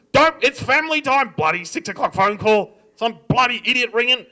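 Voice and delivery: loud, high-pitched irritated rant; exasperated dad grumbling loudly; mad dad. Acted. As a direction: rail at the caller like an outraged dad. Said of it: "it would drive my dad mad".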